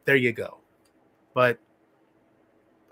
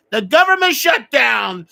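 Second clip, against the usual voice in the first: loud, slow, raised pitch; mock announcer; 7 semitones higher, 9 dB louder, slower than usual. Streamer turns to the news with a mock booming headline. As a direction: boom the headline out.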